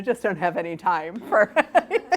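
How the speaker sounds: real laughter building through the words; breaking into laughter. Real, not acted. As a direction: barely hold it together till the last word bursts into laughter. Retelling friend's funny made-up story.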